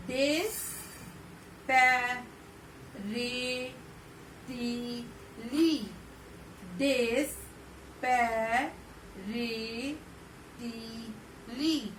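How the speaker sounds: डेस्पैरेटली said very slowly, syllable by syllable; slow repeat-after-me. Shown as a demonstration. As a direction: drag डेस्पैरेटली out so the class can copy it. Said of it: "सारे बच्चे मेरे साथ repeat करिए".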